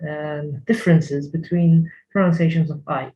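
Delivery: ordinary talk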